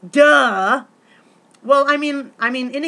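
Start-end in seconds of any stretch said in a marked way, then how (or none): 0.1-0.8 mock duh. Real, not acted. Mocking obvious goal.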